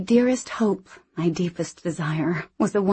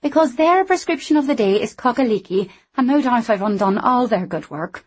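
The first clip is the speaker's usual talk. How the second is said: higher, brighter character voice; lively young Scottish woman; 6 semitones higher, 4 dB louder than usual. Acted. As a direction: play her cheeky and quick. Anna voiced.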